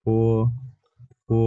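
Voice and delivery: ফো said with a puff of air on the ph and a long o; careful teacher demo of ফো; Chinese po demo. Shown as a demonstration. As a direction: breathe out on ফো, stretching the o. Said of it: "পির উচ্চারণ ফ এর মতো".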